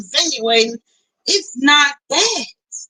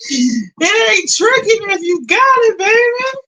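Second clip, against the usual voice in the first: hammed-up teasing; 9 semitones higher than usual. Streamer teasing viewers about paying, high hammed-up tease. Repeats own saying, hammed up.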